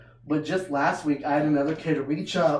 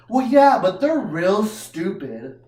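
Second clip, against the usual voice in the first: put-on bossy kid; 4 semitones higher, 7 dB louder, slower than usual. Child speaking.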